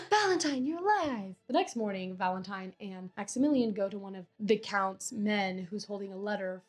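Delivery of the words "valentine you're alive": high-pitched mock amazement; astonished. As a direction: act out amazed, breath-caught relief. Maximilian finds Valentine alive.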